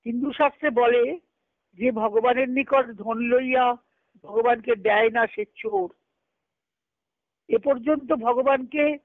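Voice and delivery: ordinary talk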